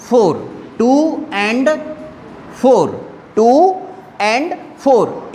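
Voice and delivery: टू and four drawn out very slowly; teacher dictating the number word by word; slow dictation. Shown as a demonstration. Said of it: "slow dictation".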